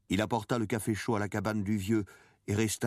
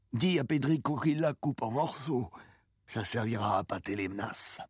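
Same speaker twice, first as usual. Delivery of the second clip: put-on old man's raised pitch, falling at the end; old fisherman instructing; 6 semitones higher than usual. A narrator's reading. Old man telling the boy about the fish head, instructing. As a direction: hand down the instruction in character.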